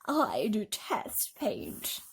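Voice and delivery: lightly voiced stately spider, slow; announcement. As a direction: declare it slowly. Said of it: "Miss Spider announced".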